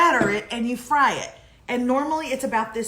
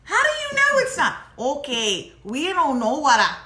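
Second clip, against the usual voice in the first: a playful North Dakota twang, pitched way up; mock Dakotas accent; 3 semitones higher, 4 dB louder than usual. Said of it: "talking like you're in, uh, the Dakotas".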